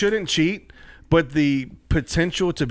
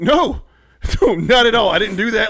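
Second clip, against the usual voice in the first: high, loud retold protest; mock-panicked protest of innocence; flustered denial; 8 semitones higher, 8 dB louder than usual. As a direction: deny it all in a panicked burst.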